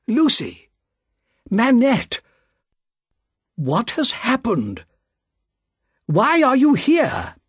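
put-on old banker calls out in shock at the sudden arrivals; alarmed cry. A narrator's reading. Mister Lorry cries out to Lucy and her father, startled. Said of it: "cried Mister Lorry".

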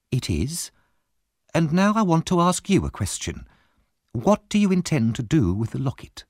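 Mrs Bumble. A narrator's reading.

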